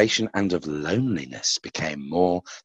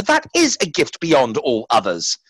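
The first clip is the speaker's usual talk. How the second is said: proud cry; 6 semitones higher than usual. A narrator's reading. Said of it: "cried Lucifer".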